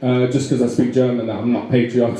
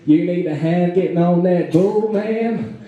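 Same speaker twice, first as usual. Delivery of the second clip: slow, higher Texan drawl; hammed-up Texan, stretched out and rising high; Texan accent; 5 semitones higher, slower than usual. Friendly Texan offers help with a bull, Texan accent. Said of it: "this nice guy came up to me and he said".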